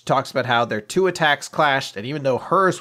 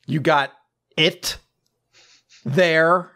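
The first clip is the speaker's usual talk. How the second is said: high loud put-on voice with long pauses; mock captain, drawn out; 5 semitones higher, 4 dB louder, much slower than usual. Captain asking again, high loud voice with long pauses. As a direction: stretch it out with dramatic pauses.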